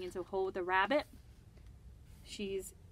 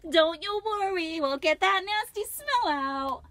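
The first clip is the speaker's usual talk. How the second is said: put-on high, slow, louder cheerful girl voice; Lucy reassuring; 10 semitones higher, 10 dB louder, slower than usual. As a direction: coax it along as Lucy. Lucy washing a skunk.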